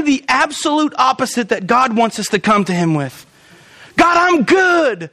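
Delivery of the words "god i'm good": high, drawn-out mock confidence; mock self-satisfied. Believer telling God all is well, mock-confident. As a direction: sing out the phony confidence, stretched and high.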